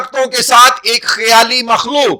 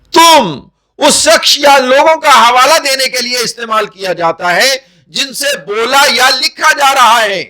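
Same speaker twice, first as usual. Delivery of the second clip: ordinary delivery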